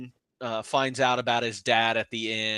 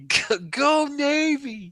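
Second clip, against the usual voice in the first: high, slow, laughing go Navy; a giddy mock cheer; 14 semitones higher, much slower than usual. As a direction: squeal it through laughter. Laughing, mimicking the movie line.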